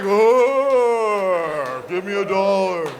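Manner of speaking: long-drawn zombie moan; mock zombie. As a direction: groan it slowly like a begging zombie. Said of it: "Looks like a zombie convention".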